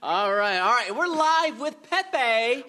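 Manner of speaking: high, drawn-out announcer call; show intro announcement. Pretend. Host opening the podcast, high drawn-out announcement. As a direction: stretch out the intro on a high pitch.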